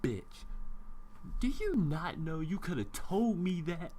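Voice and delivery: ordinary talk, nothing marked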